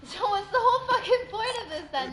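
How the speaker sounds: laughing while asking; half-laughing disbelief. Real, not acted.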